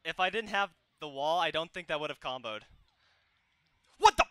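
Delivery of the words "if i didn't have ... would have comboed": usual speaking voice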